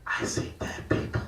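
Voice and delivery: breathy ghost-movie whisper; spooky whisper. Retold or imitated. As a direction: hush it like a creepy movie line. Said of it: "I just go".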